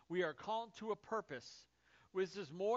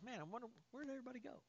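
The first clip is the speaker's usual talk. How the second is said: mock bewildered Jesus; 5 semitones higher, 10 dB quieter, much faster than usual. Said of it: "He's not, like, sitting here going".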